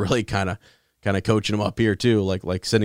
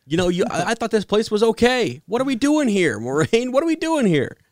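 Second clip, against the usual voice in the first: much higher, whiny complaining voice; whiny Matt; 13 semitones higher, 4 dB louder than usual. Retold or imitated. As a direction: play Matt, scared and whining at Moraine. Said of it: "He comes in like".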